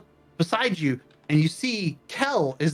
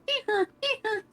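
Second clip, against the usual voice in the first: comical honk; 4 dB quieter than usual. Acted. Comical sound as she lands.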